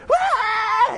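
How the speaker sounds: loud high mock toddler wail of joy; toddler's joyful shout. Imitating daughter Amy in joy, a loud high wail. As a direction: yell it like a thrilled little kid. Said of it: "She's either".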